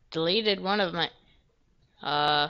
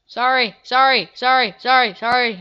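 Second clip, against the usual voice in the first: louder, higher string of sorries; loud rushed apology; 4 semitones higher, 8 dB louder than usual. Real, not acted. Player squeezing past inmates, loud hurried sorries. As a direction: blurt out sorry over and over.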